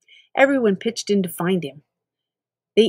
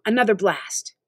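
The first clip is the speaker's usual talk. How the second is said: usual speaking voice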